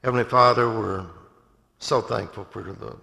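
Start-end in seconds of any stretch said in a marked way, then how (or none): none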